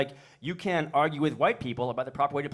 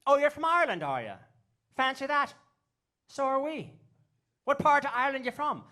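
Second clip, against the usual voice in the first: put-on high-pitched woman's voice with Irish accent; Irish lady; 12 semitones higher than usual. Irish lady answering two fake Irishmen, high Irish accent. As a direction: play the real Irish lady, high and curious. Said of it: "and she said".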